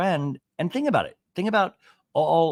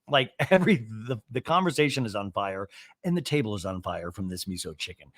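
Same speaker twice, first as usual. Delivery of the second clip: ordinary talk, nothing marked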